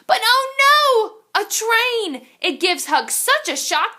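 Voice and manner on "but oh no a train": high, loud and slow oh no; alarmed. A narrator's reading. Storyteller reading aloud, alarmed as the train appears. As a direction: cry out in alarm.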